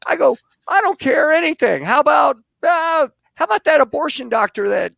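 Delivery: a pushy editor in a hammed-up Jimmy Stewart voice. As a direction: boom out the folksy editor's encouragement. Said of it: "I put on my Jimmy Stewart voice".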